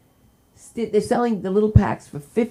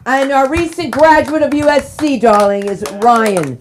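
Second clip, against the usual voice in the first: hype introduction; 8 semitones higher, 8 dB louder than usual. Real, not acted. Host hyping a new grad.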